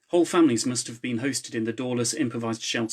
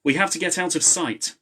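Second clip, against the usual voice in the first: higher, raised urgency; urgent call; 6 semitones higher, 3 dB louder than usual. A narrator's reading. Ewan urging the team to hide, higher and raised. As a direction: push them to hide.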